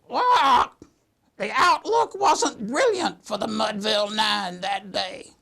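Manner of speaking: high squawk, then drawn-out parrot recital; squawking bird. Acted. Bird reciting Casey at the Bat for customer, squawky parrot voice. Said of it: "and he goes".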